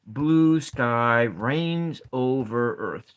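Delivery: very slow, each word drawn out; slow sounding out. Shown as a demonstration. Teacher testing a haiku line for students, slow. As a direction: stretch every syllable to count it. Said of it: "seven syllables".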